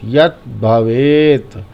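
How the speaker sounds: यत् भवेत slowly, यत् stopped short, भवेत drawn out; halted half letter. Shown as a demonstration. Teacher shows the halant, clean stop after yat. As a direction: stop the tongue on त् in यत् भवेत, then continue. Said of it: "तो यहीं से बोलेंगे भवेत".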